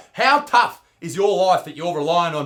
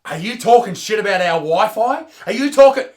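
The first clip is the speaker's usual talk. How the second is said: mock offence; 3 semitones higher, 7 dB louder than usual. Australian challenging Emmy for insulting the Wi-Fi, mock-furious. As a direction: act outraged, louder on each question.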